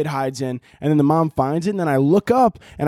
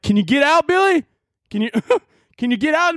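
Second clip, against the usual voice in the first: teasing parent; 10 semitones higher than usual. Pretend. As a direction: taunt the kid stuck in the dryer. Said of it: "be like".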